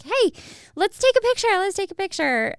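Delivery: put-on high, bright child pleading to take a picture; be the excited little kid begging for photos; eager kid. Kid begging parents for a picture, high and eager. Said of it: "always that kid who was like".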